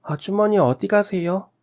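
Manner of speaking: put-on high-pitched child's voice; little child. A narrator's reading. Child asks the neighbour where she's going, high little-kid voice. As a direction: ask like a curious little kid.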